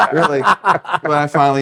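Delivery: laughing it off, high; a real laugh spilling into the words. Real, not acted. Wrestler recalling tears, laughing it off.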